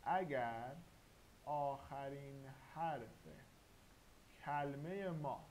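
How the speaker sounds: slow emphasis. Shown as a demonstration. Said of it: "خیلی خوب گوش کنید".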